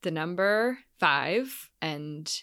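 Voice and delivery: slow, halting, picked-out words; very slow, with gaps between words; careful wording. Real, not acted. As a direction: spell it out cautiously, piece by piece. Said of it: "I'm gonna actually be careful with my language".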